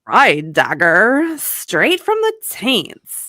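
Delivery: loud, drawn-out gruff hero act; spat verdict. Acted. Yamaha judging Frank's wound, spat out dramatically. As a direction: spit out a hammy diagnosis. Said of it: "and spat".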